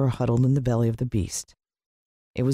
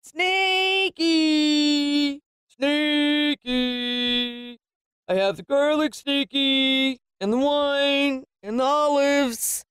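high, drawn-out singsong calling; Mister Sniggles, booming; 14 semitones higher, much slower than usual. A narrator's reading. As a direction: stretch each phrase out like calling a pet from outside. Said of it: "His voice boomed through the door".

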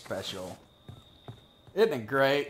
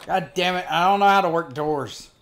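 loud, higher exasperated yell; frustrated outburst; 4 semitones higher, 8 dB louder than usual. Real, not acted. Player who cannot open doors, loud frustrated yell. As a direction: blow up at the stupid doors. Stuck at door in game.

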